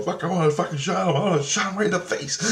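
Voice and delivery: high-pitched imitation of worked-up ranting; retold agitated outburst. Man itching to shoot him in the face, agitated.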